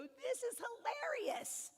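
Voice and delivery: high, slow, delighted reframing; mock delight. Shown as a demonstration. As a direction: turn the stress into a big slow laugh of delight. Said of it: "switch that around and go".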